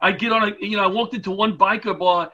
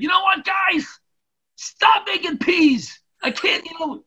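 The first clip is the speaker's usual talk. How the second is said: higher, half-shouted plea to the guys; mock pleading firefighter; 10 semitones higher than usual. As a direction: ham up a desperate complaint at the table. Firefighter quoted begging at dinner.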